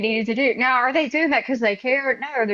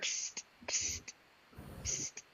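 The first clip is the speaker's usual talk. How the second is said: hushed "psst" to get attention; imitated psst; 22 dB quieter, much slower than usual. Man round a corner to a tourist, furtive psst. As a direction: imitate a secretive psst, very soft. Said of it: "and I heard".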